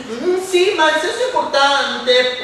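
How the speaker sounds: pry like a nosy group member; nosy Janice. Acted. Janice voiced again. (Janice curiosa, voce più acuta)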